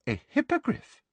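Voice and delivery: high surprised query. Acted. Umbridge asks Malfoy, high surprised query. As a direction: pounce on the word as a surprise.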